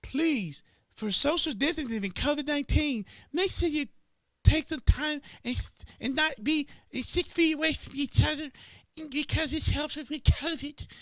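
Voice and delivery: high, sing-song mock announcement; lilting airline announcer parody; mock flight announcement. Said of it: "they make sure they say".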